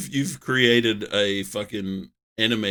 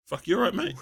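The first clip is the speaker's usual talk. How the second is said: ordinary talk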